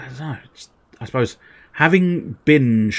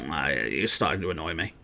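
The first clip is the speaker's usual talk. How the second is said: low, soft annoyed grumble; grumpy Snorlax voice; 6 semitones lower, 10 dB quieter than usual. Acted. Snorlax grumbling at Pikachu, low and soft. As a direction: grumble low like a woken giant. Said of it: "Snorlax annoyed by Pikachu".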